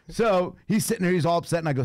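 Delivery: ordinary talk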